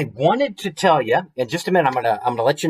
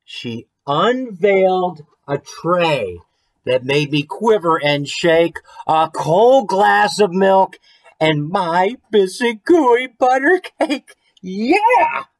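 excited storybook reveal; 6 semitones higher, slower than usual. A narrator's reading. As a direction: build to a joyful cheer on the cake. Story climax, excited reading.

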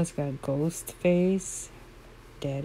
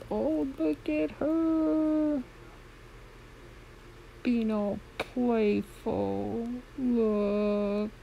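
cooing; 7 semitones higher, much slower than usual. Real, not acted. Watching the playful foal, high slow cooing. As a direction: melt over her and coo it out.

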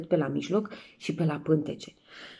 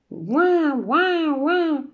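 put-on baby wail; 8 semitones higher, 3 dB louder, much slower than usual. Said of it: "nu țipa ca alți copii".

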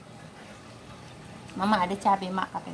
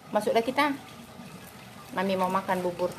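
normal talking voice

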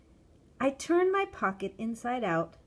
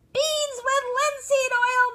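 very high, loud long call; shrill child's drawn-out shout; calling from afar; 11 dB louder than usual. A narrator's reading. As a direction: holler the order from yards away. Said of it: "called out to the man".

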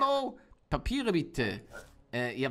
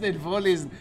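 ordinary delivery

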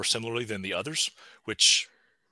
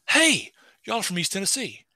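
higher-pitched, imitated friendly call-out; hailing strangers; 9 semitones higher than usual. Man walks up to mother and daughter from East Tennessee, hailing them. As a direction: act out hailing someone from back home.

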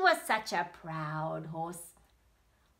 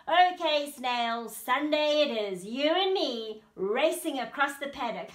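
loud, higher boastful voice; cocky proud horse; 6 semitones higher, 7 dB louder than usual. Acted. Proud horse sets race day with snail, loud and cocky. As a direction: boom out the challenge with swagger.

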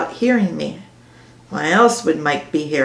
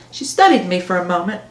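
usual speaking voice

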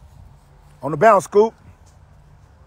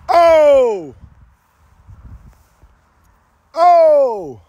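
excited oh; 7 semitones higher, 4 dB louder than usual. Real, not acted. Cheering a caught deep pass.

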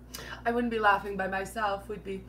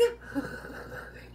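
airy unvoiced laugh at her own joke; breathy giggle; 10 semitones higher, 7 dB quieter than usual. Real, not acted. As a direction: let a soft breathy laugh slip out.

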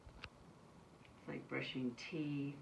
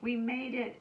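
higher, louder happy exclaim; a child cheering we made it; 10 semitones higher, 8 dB louder than usual. A narrator's reading. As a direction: burst out with relief. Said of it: "Ava exclaimed".

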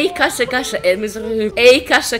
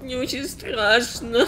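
scared; 8 dB quieter, slower than usual. Real, not acted. Scared kids in horror game.

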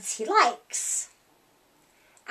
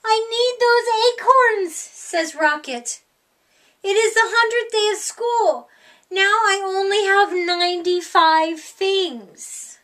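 put-on higher, louder voice of a fretting young dog; upset little dog; 6 semitones higher, 4 dB louder than usual. Rocket telling Bella he needs the acorns, upset and pleading. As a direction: plead it like a worried puppy missing his treasures.

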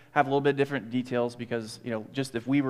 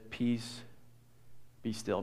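a soft, steady word of peace; calm command; 10 dB quieter, slower than usual. Acted. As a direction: hush the storm gently. Jesus calms the storm.

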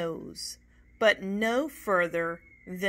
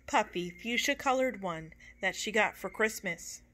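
ordinary delivery, nothing marked

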